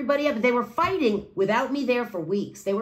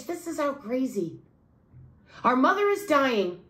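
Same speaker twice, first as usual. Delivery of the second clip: usual speaking voice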